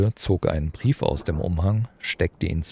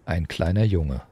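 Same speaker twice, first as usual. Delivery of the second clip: ordinary delivery, nothing marked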